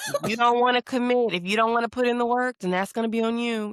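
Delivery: high-pitched mock dad lecture; imitating dad. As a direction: recite dad's warning as a teasing impression. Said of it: "he used to love".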